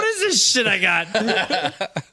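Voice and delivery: high, slow, laughing mock outrage; cracking up at the tiny umbrella; laughing disbelief. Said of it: "I'm like".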